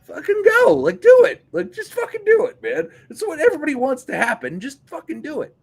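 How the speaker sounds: high, loud pumped-up urging; hyped urging. Acted. Urging a wrestler on, loud hype. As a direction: fire him up to just go.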